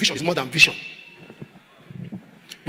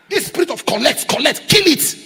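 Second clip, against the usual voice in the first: high, louder rebuke; rebuking shout; 6 semitones higher, 4 dB louder than usual. Acted. Preacher to congregation, rebuking shout. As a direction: kill it, climb to a shout.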